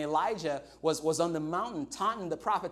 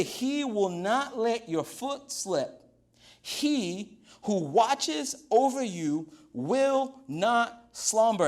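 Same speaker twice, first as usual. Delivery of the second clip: drawn-out, raised reading of the verse; slow scripture reading; 4 semitones higher, much slower than usual. Acted. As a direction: read it slowly, savouring each word. Pastor reads psalm verse slowly.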